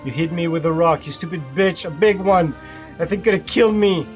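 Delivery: put-on the bully raging at the old woman; angry outburst. A narrator's reading. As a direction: hurl it at her, outraged. Bully shouting at grandmother.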